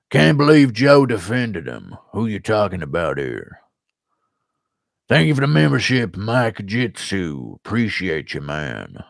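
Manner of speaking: imitation American Southern twang; American Southern accent. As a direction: read the chat in a Southern US accent. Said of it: "when I'm doing this accent".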